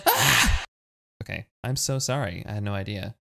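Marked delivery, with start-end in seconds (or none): 0.1-0.6 high unvoiced squeal of glee; high breathy squeal of delight. Real, not acted. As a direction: let out a sharp giddy squeal. Shriek at news.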